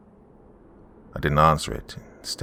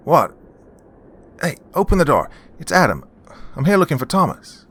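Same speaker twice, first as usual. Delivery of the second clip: much higher, louder voice calling in; raised, insistent visitor's voice; younger brother at the door; 10 semitones higher, 5 dB louder than usual. A narrator's reading. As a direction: call urgently through a closed door. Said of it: "I heard a familiar voice".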